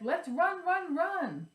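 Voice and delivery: high, loud urgent cry; excited. Acted. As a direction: shout it like a scared little pumpkin. Pumpkin character in rhyme.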